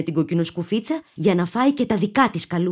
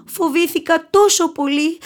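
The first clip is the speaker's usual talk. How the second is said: higher, tearful cry of relief; a girl crying out after a fright; mildly tearful, voice up high; sobbing; 10 semitones higher, 3 dB louder than usual. A narrator's reading. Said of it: "φώναξε με λυγμούς".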